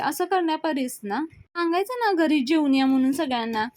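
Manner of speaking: needling retort. Acted. Sulakshana needles the hosts about the meal, pointed retort. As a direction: play a needling, exasperated relative.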